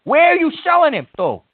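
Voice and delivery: high, indignant accusations; real outrage; outraged rant. Real, not acted. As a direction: pitch climbing in disbelief. Host confronts prank caller over T-shirts.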